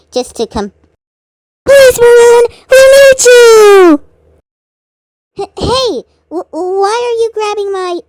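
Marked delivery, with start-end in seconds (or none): 1.6-4.0 high, loud drawn-out begging; desperate loud begging; pleading shout. Acted. Friends begging Maroon to join. 5.4-8.0 higher, stammering startled yelp; flustered stammering protest. Acted. Maroon grabbed against his will.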